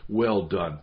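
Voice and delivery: ordinary delivery, nothing marked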